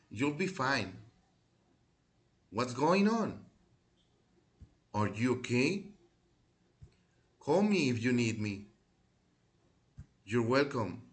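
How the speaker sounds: slow, clear teacher model lines, spaced out; model for repeating. Shown as a demonstration. Teacher modelling phrases for pupils to repeat, clear and loud. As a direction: say each phrase cleanly for the class to echo. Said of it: "repeat after me".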